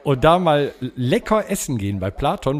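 ordinary talk

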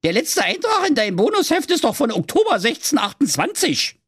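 put-on high, cheeky Berlin girl voice; little girl Ute. Ute hands the moon rolls, high cheeky girl voice. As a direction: play the sassy little girl, pitched high.

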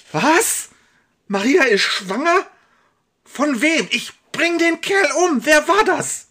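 high, loud shout of shock turning to rage; shocked furious outburst. Acted. Josef shouts at Salomon on hearing of the pregnancy, furious shout. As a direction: explode at the news, then threaten.

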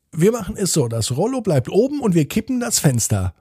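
put-on high, conciliatory child's voice; child proposing compromise. A narrator's reading. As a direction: offer the deal as the brother.

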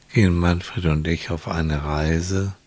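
calm hypnotic guiding tone; guided relaxation. Acted.

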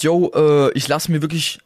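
usual speaking voice

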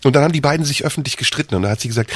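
ordinary delivery

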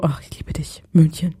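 soft whispered love line; imitated whisper. Host retelling the clip, imitated whispered love line. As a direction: breathe it like a secret. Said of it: "Und eben war so".

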